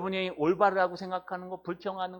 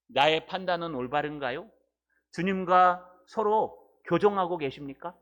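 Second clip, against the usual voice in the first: normal talking voice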